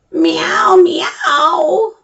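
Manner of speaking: high, loud rising meows; playful cat meows; cat meowing. Acted. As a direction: howl like a cat. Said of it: "The cat screeched and howled".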